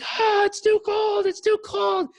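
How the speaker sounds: high, loud shriek from icy shock; panicked screaming. Retold or imitated. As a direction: imitate a man screaming under freezing water. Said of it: "he's screaming from the shower".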